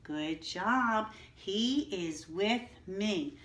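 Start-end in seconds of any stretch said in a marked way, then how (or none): 1.3-3.4 slow, even sentence read aloud; slow model reading. Shown as a demonstration. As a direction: read it slowly so they can follow. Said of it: "read them with me again".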